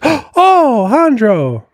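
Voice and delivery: high, gushing squeal of delight; excited recognition. Retold or imitated. Imitating a ballerina seeing the magician's Instagram, excited squeal. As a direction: gasp and swoon at a familiar name. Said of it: "she goes, [gasps]".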